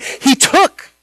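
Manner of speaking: "took" punched high, held at the end; stress on TOOK. Real, not acted. Preacher underlining how Peter seized Jesus, emphatic "took". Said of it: "I want to emphasize that word".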